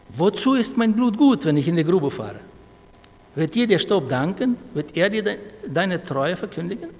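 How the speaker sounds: loud, high, pleading questions; forceful scripture reading. Acted. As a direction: declaim the psalm with ringing force. Reads psalm aloud at full force. (kraftvoll vorgetragener Psalm)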